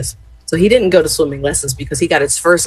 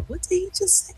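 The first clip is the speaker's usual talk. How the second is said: very soft, high-pitched aside; small, hushed voice imitating someone puzzled; imitated hushed question; 14 semitones higher, 12 dB quieter than usual. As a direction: ask it under your breath, pitched high. Mother quietly asks beside her.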